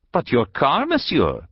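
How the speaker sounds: put-on high-pitched old woman querying; old peasant woman. A narrator's reading. Peasant woman asking about the stranger's car, high voice. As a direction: raise the voice high in puzzled protest.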